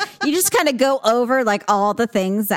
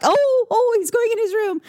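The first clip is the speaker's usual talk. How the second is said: mock alarm; 12 semitones higher than usual. Mocking teen son's exit.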